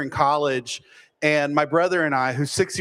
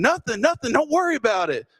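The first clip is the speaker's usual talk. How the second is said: retold yelling back; 7 semitones higher than usual. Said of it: "We're yelling back in unison".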